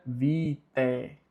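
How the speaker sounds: "vitae" as "vi-té", the A dropped; silent A. Shown as a demonstration. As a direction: end "vitae" on an open E. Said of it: "a pronúncia dele é muda". ("vitae" com o A mudo, "vité")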